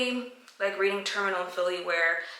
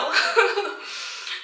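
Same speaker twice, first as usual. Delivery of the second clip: real high-pitched giggle; amused laughter, pitched well up; 12 semitones higher, 4 dB louder than usual. Real, not acted. Laughs at own worried speculation.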